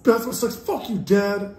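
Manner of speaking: sass dad in a hammed-up high voice; rebellious son. Acting out the defiant son.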